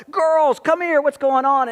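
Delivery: called out high and loud across the levee; calling out. Retold or imitated. Father calling his running daughters over to him, loud. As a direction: hail the girls from a distance, slow.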